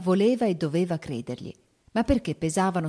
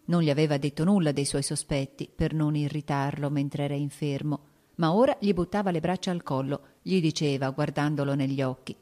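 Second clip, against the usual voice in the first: plain reading voice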